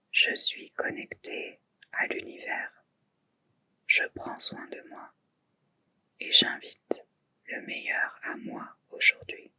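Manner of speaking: barely audible whisper; inward murmur. Shown as a demonstration. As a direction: whisper the affirmation to yourself, very softly. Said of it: "murmurez-vous intérieurement trois fois le Sankalpa". (murmure intérieur, chuchoté tout bas)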